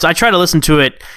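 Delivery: ordinary talk, nothing marked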